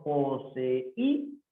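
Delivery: "Poseí" sounded out po-se-í, rising at the end; syllable separation. Shown as a demonstration. Teacher confirming the separación, "Poseí" in syllables. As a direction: step through "Poseí" syllable by syllable. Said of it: "Sería así".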